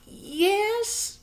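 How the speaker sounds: very high little mother's voice; a tiny anxious mother agreeing; Homily's voice. A narrator's reading. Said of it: "she said".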